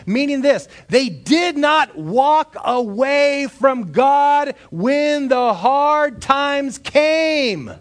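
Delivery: loud, high, fervent preaching; shouted pulpit preaching; full-force preaching. Acted. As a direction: preach it out with rising fire.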